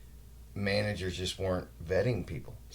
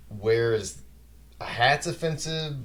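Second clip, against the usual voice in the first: higher, louder disbelief; can't believe a hat offends; incredulous; 5 semitones higher, 5 dB louder, slower than usual. Real, not acted. Complaining about the hat rule, incredulous raised voice. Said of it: "indignant rant about hats".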